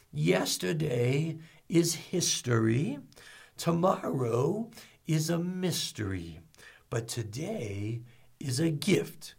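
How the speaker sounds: put-on slow, soft old tortoise sage; hushed, unhurried wisdom, fading toward a near whisper, with pauses; Master Oogway. Old Kung Fu founder sharing a great line, slowly and softly. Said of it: "He's this old tortoise".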